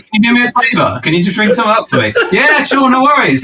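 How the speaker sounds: high, quick put-on voice for both callers; mock phone call. Co-host acting out asking Grassy a favor, high mock voice.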